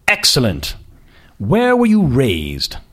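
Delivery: put-on slow, lofty prep-school British accent; slow aristocratic accent. Boss questioning the candidate slowly in an aristocratic accent. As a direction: savour each word like a bored aristocrat. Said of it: "His accent was patently aristocratic".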